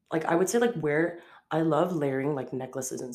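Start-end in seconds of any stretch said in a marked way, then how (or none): none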